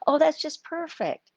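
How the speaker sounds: high, excited praise; delighted gush. Retold or imitated. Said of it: "they go".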